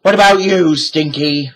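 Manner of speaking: high, loud pirate bellow; captain roaring. A narrator's reading. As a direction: roar up at his crew. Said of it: "Captain Bones roared".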